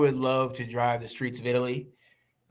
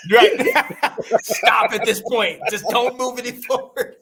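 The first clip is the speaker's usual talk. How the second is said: laughing; 7 semitones higher, 4 dB louder, slower than usual. Real, not acted. Joking about the divorce stage.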